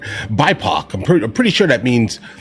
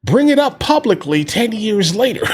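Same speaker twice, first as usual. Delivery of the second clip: much higher, sly mocking voice; hammed-up scheming politician, pitched way up; mock Republican schemer; 8 semitones higher than usual. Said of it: "Republicans are in the background like".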